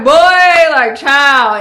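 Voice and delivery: put-on loud, high, scandalized "boy, like, child"; half-laughing loud exasperation; incredulous loud scolding. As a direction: shut him down in loud disbelief. Shocked at the marriage offer.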